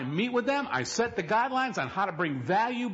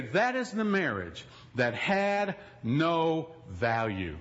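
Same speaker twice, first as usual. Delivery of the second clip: slow, weighty pronouncement; solemn declaration; 7 semitones lower, slower than usual. Pretend. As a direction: spell it out slowly, like a vow. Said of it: "And you will say".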